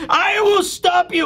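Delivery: high, slow mock-villain shout; Bakori as cyborg. Cyborg villain interrupting the reunion, high slow shout. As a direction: drag it out as a strained cyborg villain. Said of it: "Like, Bakori is a cyborg now".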